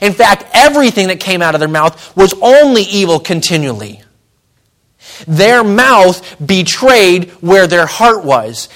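loud, slow pulpit emphasis; fervent preaching. Real, not acted. Pastor exhorting the church family, forceful preaching. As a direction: drive the point home to the pews.

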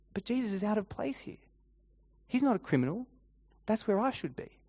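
lightly voiced troubled thought; troubled inner thought. Voicing Barabbas's thought at the cross.

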